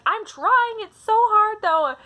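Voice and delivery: put-on high, loud childish protest; mock whiny kid. Kid protesting to older sister about reading, mock whine. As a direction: imitate a kid complaining at full pitch.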